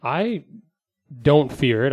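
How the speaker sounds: usual speaking voice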